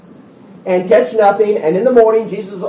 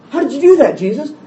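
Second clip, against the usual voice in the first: high, laughing disbelief; amazed disciples; 12 semitones higher than usual. Retold or imitated. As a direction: marvel at him, half laughing. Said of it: "And he gets in the boat".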